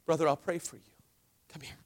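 low, soft mock concern; acted quiet, pastoral aside; hushed offer of prayer. Believer to a needy brother instead of helping, hushed and low.

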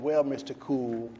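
the drill sergeant up close, quiet and slow; quiet menace. Retold or imitated. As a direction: lean in and say it close to his face. Sergeant close to his face.

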